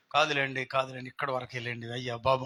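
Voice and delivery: low, soft imitation of polite begging; retold pleading. Driver begging people to move along, retold in a low pleading voice. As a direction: plead quietly, as the driver did. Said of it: "బ్రతిమలాడి ఎవరిని ఒక్క మాట".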